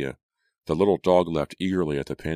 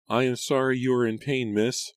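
timid maid; 9 semitones higher than usual. A narrator's reading. Maid apologizing, eyes lowered.

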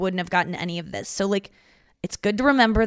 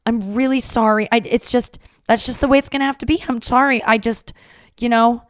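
higher, louder flustered mom voice; imitating apologetic mom; 5 semitones higher, 5 dB louder than usual. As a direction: gush apologies like a nervous mother. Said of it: "She just kept apologizing".

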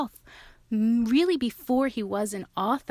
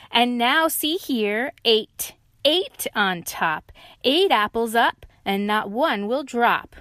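loud, slow boast; loud proud lion; excited lion; 4 semitones higher, 6 dB louder, slower than usual. Acted. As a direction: show off eight. Lion brags.

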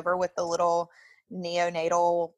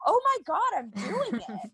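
high, bright rush of amazement; excited disbelief; 11 semitones higher than usual. Retold or imitated. Mother retelling to friends, high thrilled amazement. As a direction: gasp it out, thrilled it's working. Retold joyful realization during birth.

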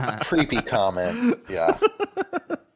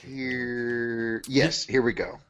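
normal talking voice

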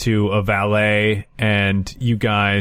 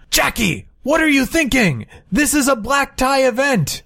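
put-on high, scolding old uncle voice; an elderly uncle chiding, pitched up; 13 semitones higher than usual.

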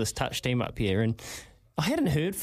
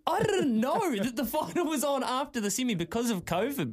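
laughing protest; 8 semitones higher than usual. Retold or imitated.

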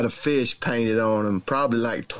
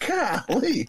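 golly; 11 semitones higher than usual. Retold or imitated. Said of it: "and I was like".

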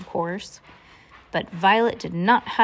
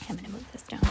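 barely audible whisper; hushed, voiceless aside; 15 dB quieter, much faster than usual. Real, not acted. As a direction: whisper it to yourself, off to the side. Muttered aside during reading.

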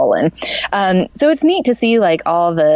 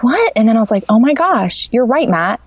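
higher-pitched startled replay; retold sudden realization; 6 semitones higher than usual. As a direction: act out it suddenly dawning on you.

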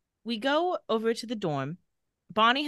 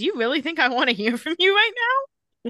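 louder, higher acted indignation; irritated retort; 7 semitones higher, 4 dB louder than usual. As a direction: play up fed-up disbelief on a call. Acted irritated phone answer.

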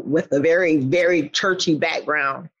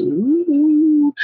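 impressed ooh; 9 semitones higher, 4 dB louder than usual. Retold or imitated. Reacting to the motto.

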